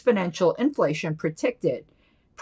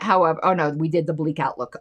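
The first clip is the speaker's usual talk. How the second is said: usual speaking voice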